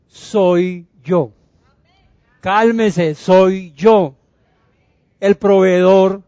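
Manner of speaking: forceful preaching, phrase by phrase; emphatic proclamation. Acted. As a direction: proclaim who you are to reassure the fearful. Preacher proclaims as Jesus, building.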